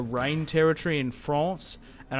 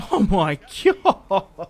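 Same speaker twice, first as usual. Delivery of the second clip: much higher, slow, laughing shock; stunned disbelief; 7 semitones higher, 3 dB louder, slower than usual. Real, not acted. Streamer sees Venice declare war and reacts in real disbelief. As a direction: gasp it out, can't believe it.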